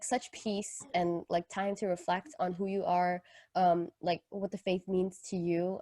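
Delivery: ordinary delivery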